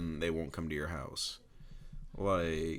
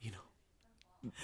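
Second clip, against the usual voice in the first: breathy whispered aside; 3 semitones higher, 14 dB quieter than usual. Real, not acted. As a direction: drop to a whisper. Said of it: "whispered repeat".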